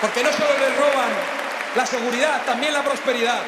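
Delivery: loud oratory punching each phrase; rally climax, projected to a crowd; balcony speech. Real, not acted.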